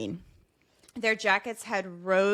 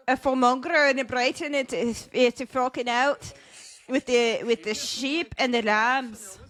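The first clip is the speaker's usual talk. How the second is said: attempted Irish accent; 3 semitones higher than usual. Host practising with sheep talk, attempted Irish accent. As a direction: ham up a rough Irish accent. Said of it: "Okay, so you got to say".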